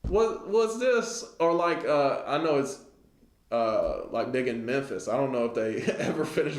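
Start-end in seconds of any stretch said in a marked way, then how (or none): 0.0-1.2 higher, slow mock bafflement; puzzled at a half-built project. Onlooker mocking a half-built project, slow and baffled. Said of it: "you're like".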